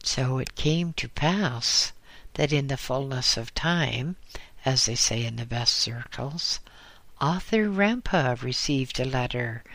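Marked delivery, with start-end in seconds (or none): none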